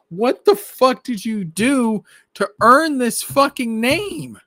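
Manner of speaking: high, slow, incredulous yell; outraged rant. Retold or imitated. Fan ranting at Rey about the Skywalker name, high incredulous yell. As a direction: demand she justify herself. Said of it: "I'm just like".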